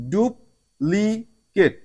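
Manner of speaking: Duplicate stretched very slowly, each syllable apart; duplicate spelled out in slow motion. Shown as a demonstration. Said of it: "ইউ থেকে আমরা রস্যকারের উচ্চারণ পেয়েছি".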